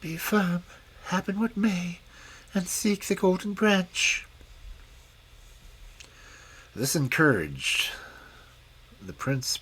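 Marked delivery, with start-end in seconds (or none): 0.0-4.3 a kind unseen voice reassuring him; soft encouraging fairy voice. Acted. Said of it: "a soft voice came to his ear".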